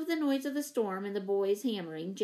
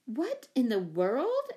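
slow, emphatic astonishment; startled exclamation; 3 semitones higher, 4 dB louder, slower than usual. A narrator's reading. Mother finding a mess in the kitchen, exclaiming. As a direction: draw the question out, taken aback. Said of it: "exclaimed Mother".

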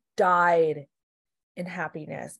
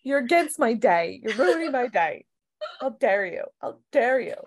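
higher, a bit louder, hammed-up entitled tantrum; mock Karen rant; 5 semitones higher, 3 dB louder than usual. Imagining Kenley yelling at Starbucks staff, mock tantrum. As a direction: play a customer outraged at a wrong coffee order. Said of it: "I could totally see her yelling at Starbucks people".

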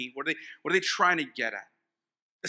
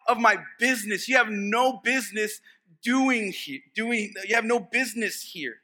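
demon confronting Jesus; 5 semitones higher than usual. Acted. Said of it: "what the demon was saying to Jesus".

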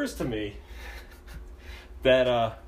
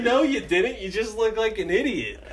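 high, loud put-down; mock exasperated driver; retold scolding; 9 semitones higher, 3 dB louder than usual. Driver scolds drunk prankster.

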